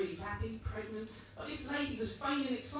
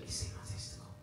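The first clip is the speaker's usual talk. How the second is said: breathless embarrassed whisper; 11 dB quieter than usual. Retold or imitated. Patient whispering to the receptionist, embarrassed whisper. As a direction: whisper it so the pregnant women won't hear. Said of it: "so I just whispered".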